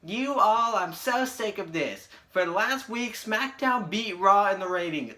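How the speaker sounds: high, loud mocking impression of her ranting; shrill mock Stephanie. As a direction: squeal it out like a bratty boss. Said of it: "Stephanie was like".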